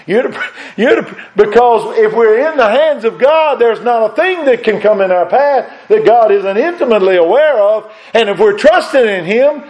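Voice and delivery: high, loud rising preach; full-force preaching. Acted. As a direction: build to the climax, then hold it. Preaching climax with laugh.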